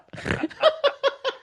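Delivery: high laugh breaking into a snort. Real, not acted. Guest cracking up at the host, snorting laugh. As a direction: laugh helplessly until you snort. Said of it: "Oh, another snort".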